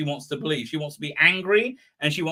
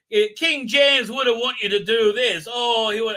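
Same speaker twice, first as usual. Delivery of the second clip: mocking lecture; 5 semitones higher, 5 dB louder than usual. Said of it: "she got her lecturing face on".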